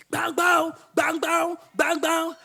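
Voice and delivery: high, even repeated clangs; imitated bell. Storyteller imitates break bell ringing, clanging. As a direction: mimic a ringing school bell. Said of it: "I just heard brick bell".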